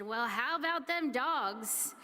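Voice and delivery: put-on slow, drawn-out Southern accent on "Well, how about them dogs?"; Southern drawl, stretched and slow; thick Southern accent. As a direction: imitate a Southern football fan's thick accent. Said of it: "In a very thick Southern accent".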